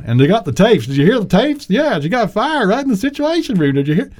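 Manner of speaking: put-on Southern accent, teasing. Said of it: "I did the Southern accent".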